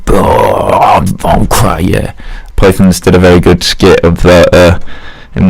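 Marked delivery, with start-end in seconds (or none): 0.1-2.1 Cray impression. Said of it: "guy that was meant to be Cray".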